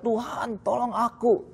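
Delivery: mock cry for help. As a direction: Peter sinking, calling out for help to the Lord, in a mildly acted desperate plea. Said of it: "dia berteriak".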